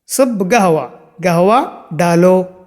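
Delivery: normal talking voice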